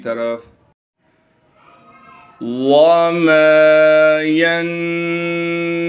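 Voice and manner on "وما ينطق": ينطق chanted with heavy ط and ق, humming held ن; heavy qaf, ghunna. Shown as a demonstration. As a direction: hold a nasal hum on the ن of ينطق, thicken the ق. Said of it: "क़ाफ़ को भी मोटा करके पढ़ेंगे". (ينطق में क़ाफ़ मोटा, नून में गुन्ना)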